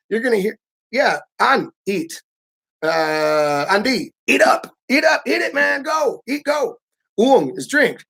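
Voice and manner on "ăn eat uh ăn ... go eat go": mock shout, higher, eat up; host shouting at a guest to eat, higher; relentless urging. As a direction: bark orders to eat like a pushy host. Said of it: "they're relentless".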